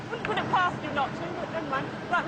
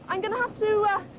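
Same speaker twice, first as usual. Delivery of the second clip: ordinary delivery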